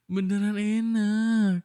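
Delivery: slow, drawn-out reassuring words; slow reassurance. Real, not acted. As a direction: coax her gently, taking your time. Reassuring the sulking wife. (menenangkan istri yang ngambek, pelan dan lembut)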